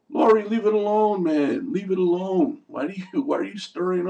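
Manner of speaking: high, loud plea to leave it alone; Laurie, leave it alone, yelled high and loud; exasperated yell. Real, not acted. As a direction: shout at the screen, fed up. Yelling at the character on TV.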